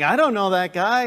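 loud, adamant brush-off as Peter; insistent mock denial. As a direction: deny it hotly, acting it out. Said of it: "He was so adamant".

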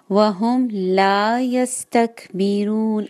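slow वहुम ला यस्तकबिरून, la held long; slow correct stretch. Shown as a demonstration. Teacher models the correct reading. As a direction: hold the la two counts. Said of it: "ठीक है".

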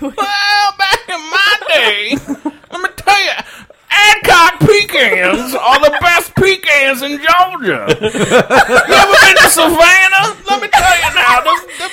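put-on very high, slow, laughing old Southern drawl; old Southern Commodore. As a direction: ham up a creaky old Georgia gent. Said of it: "I can go full Commodore".

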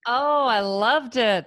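high, slow delighted praise; excited gushing. Real, not acted. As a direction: stretch it out with delight. Host gushing after story.

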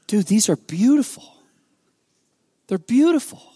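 high, choked-up wonder, acted; retold weepy amazement, pitch climbing; imitated tearful awe. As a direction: play a friend overcome by beauty. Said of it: "he's just sobbing".

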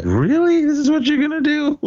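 high mock disbelief; incredulous. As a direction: squeak it out in disbelief. Mock incredulous retold reaction.

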